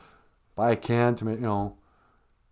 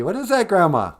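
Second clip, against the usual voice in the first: lightly voiced grandchild, higher and louder; grandchild's question; 7 semitones higher, 6 dB louder than usual. Grandchild asking Grandma about spicy food, lightly voiced. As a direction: ask Grandma with mild mock alarm.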